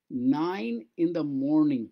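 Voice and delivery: each word drawn out slowly for learners; slow model reading. Shown as a demonstration. Teacher to Bengali students, slow careful reading. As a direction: read it slowly so students can follow. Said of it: "teacher models English phrase slowly".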